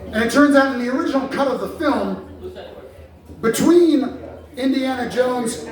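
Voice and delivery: ordinary talk, nothing marked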